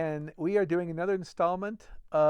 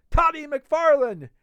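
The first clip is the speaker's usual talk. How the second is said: loud, high and slow, stretching the name; loud repeated hail; 11 semitones higher, 11 dB louder, slower than usual. A narrator's reading. Fetz pinning McFarlane by name, slow loud hail. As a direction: bellow the name again to pin him. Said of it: "repeated Fetz".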